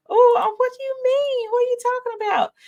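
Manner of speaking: high, put-on fake innocence; mock innocent Tisha. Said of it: "she try to act like".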